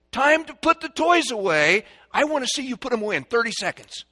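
high, loud parental announcement, speeding up; clean-up call. Shown as a demonstration. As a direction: announce a race to the children.

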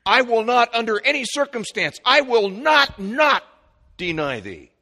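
high, loud, hammering each NOT; emphatic denial. Retold or imitated. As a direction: stress "not" hard every time, building to "deny thee". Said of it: "a double negative is emphatic".

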